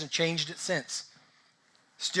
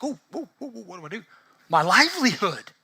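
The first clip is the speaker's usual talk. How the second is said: mock panic; 3 semitones higher than usual. Coffee shop owner hearing of a rival shop, hammed-up panic. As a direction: play up a little dramatic panic. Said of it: "kind of a little panic".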